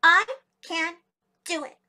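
tiny muskrat announcing it word by word; loud, high start, pausing for emphasis; proud declaration. A narrator's reading. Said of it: "puffed out her chest very proudly".

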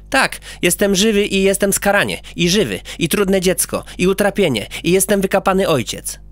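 put-on proud child listing his nicknames; boastful little boy voice; boy character.

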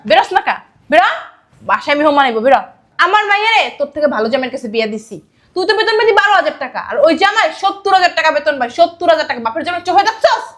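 loud, heated tirade; angry berating. Acted. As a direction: scold and belittle an unwelcome visitor. Mother berates former son-in-law. (রেগে গলা তুলে অপমান)

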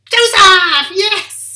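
high, loud triumphant cry of the name; excited reveal. Real, not acted. Presenter revealing the answer to children, high and loud cheer. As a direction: announce the answer with a big cheer.

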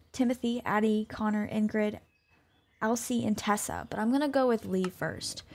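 normal talking voice

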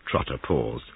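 plain reading voice

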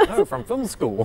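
high, helpless laughter; cracking up mid-sentence; laughing hard. Real, not acted. Laughing fit.

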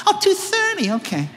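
put-on airy high young voice; high ditzy girl. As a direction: sound dawning, high and light. Intern realizing, high voice.

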